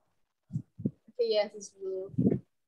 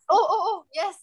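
excited; 9 semitones higher, 3 dB louder than usual. Real, not acted. Recognising the baby photo.

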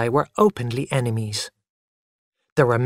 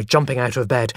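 ordinary delivery

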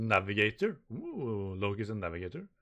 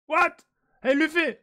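much higher, louder yell; shocked yell; 8 dB louder than usual. Acted. Crewmate yelling after Luffy jumps down, shocked.

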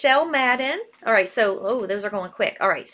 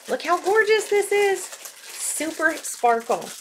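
higher, gushing over the sparkle, then slowing right down on the last words; gushing delight; 5 semitones higher than usual. Real, not acted. Host showing a necklace to her viewers, thrilled. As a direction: sell the sparkle with real delight.

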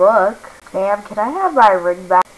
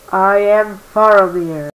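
ordinary talk

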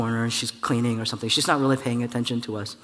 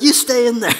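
laughing mock child command; 9 semitones higher, 8 dB louder than usual. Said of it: "And he says".

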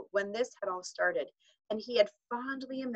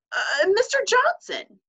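much higher, louder, slow shocked woman's voice; 9 dB louder, slower than usual. A narrator's reading. Teacher to headmaster, a high shocked cry. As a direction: gasp his name in shock. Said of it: "said Missus Hunt in a shocked tone".

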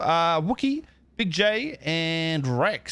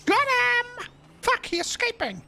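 high, excited yelp at the game; excited gamer. Real, not acted. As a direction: squeal with triumph over the kill. Gaming excitement.

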